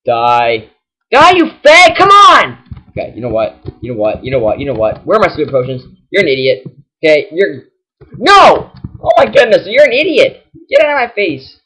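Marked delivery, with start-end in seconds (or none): 1.1-2.6 1 frustrated yell. Real, not acted. Yelling at enemy Pokemon. 8.2-11.6 1 frustrated outburst. Real, not acted. Yelling at the game.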